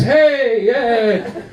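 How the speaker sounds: high, rowdy drunk passenger imitation; mock drunk. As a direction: play a drunk man getting handsy. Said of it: "he's, like, grabbing tits".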